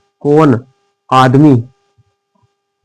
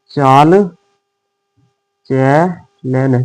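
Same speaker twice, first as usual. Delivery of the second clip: slow; much slower than usual. Shown as a demonstration.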